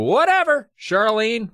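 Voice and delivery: high-pitched, dismissive "whatever"; mock-teen dismissal. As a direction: brush her off like a mock teenager. Voicing dismissive teenagers in retelling.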